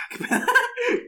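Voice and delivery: high-pitched laughing burst; real laughter breaking out. Real, not acted. Laughing at his own answer.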